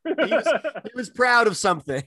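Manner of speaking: high, laughter-broken speech; laughing tease. Real, not acted. Co-host teasing runner about coach's pride, laughing. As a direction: giggle through the jab.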